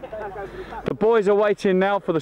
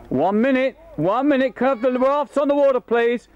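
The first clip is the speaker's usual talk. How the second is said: calling out; 7 semitones higher than usual. Real, not acted. Calling rafts to the water.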